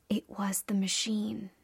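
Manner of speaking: raspy, unsteady whisper of rage; old woman whispering. A narrator's reading. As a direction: hiss it out, trembling with fury. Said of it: "The crone whispered, her voice unsteady with rage".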